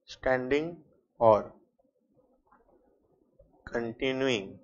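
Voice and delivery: each word spaced out and slow for copying; slow dictation. Shown as a demonstration. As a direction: spell it out word by word for note-taking. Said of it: "point number ई लिखना".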